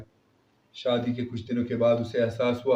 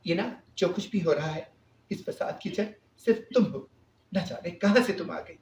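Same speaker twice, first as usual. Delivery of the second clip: angry sister-in-law; 6 semitones higher than usual. A narrator's reading. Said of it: "غصے میں بولی".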